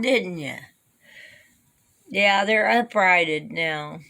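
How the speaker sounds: normal talking voice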